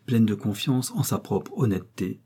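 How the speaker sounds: usual speaking voice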